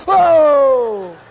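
very loud, drawn-out mock howl; imitated dog yelp. Imitates dog hit by newspaper.

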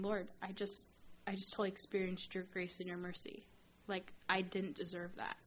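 soft, humbled awe; hushed prayer. Retold or imitated. Student thanking God for unearned mercy, hushed. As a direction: pray it quietly, slowing at the end.

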